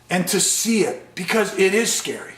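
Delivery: ordinary talk, nothing marked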